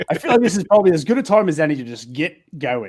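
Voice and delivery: ordinary talk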